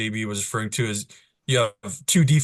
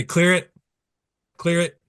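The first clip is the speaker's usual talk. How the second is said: quick, raised call to a player; sharp command; 7 semitones higher, 4 dB louder than usual. Shown as a demonstration. Referee calling to a player in the lane, sharp command. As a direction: call it out crisp and higher. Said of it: "just say".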